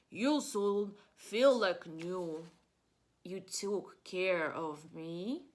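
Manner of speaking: slow, soothing bear voice; tender bear. Acted. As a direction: Bear comforting his sick friends, slowly. Bear comforts sick friends.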